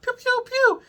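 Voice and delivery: high squeaky pew pew pew sound effects; imitated blaster shots. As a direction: mimic laser guns firing. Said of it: "and they're like".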